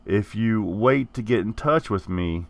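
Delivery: ordinary talk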